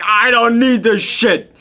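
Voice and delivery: high, loud, slow outraged yell; injured cabbie's high, loud angry outburst; fed up. Retold or imitated. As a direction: blurt it out furious and done. Cabbie hit by the cane.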